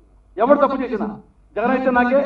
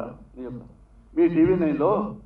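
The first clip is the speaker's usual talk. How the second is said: ordinary delivery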